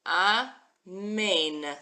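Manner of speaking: AMEN said ah-MAIN, the two syllables drawn apart; Hebrew pronunciation. Shown as a demonstration. Teacher sounding out the word for learners, AMEN as a drawn-out ah-MAIN. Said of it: "then our long final nun that sounds like an N".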